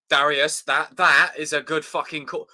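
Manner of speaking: ordinary talk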